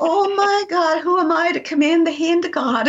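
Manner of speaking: high, astonished exclamation; awed shock. Retold or imitated. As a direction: gasp it in disbelief at herself. Shock at her own power.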